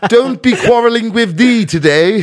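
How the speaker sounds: loud, high mock-archaic bravado; theatrical projected put-down; giving it beans. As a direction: declaim it like a hammed-up challenge. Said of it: "I started giving it beans".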